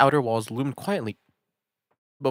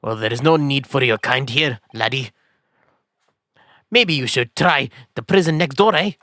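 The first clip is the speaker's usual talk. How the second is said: put-on louder, scornful Scottish accent; 3 semitones higher, 5 dB louder than usual. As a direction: sneer at the foreign lawyer, Scottish style. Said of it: "Oh, you're Scottish".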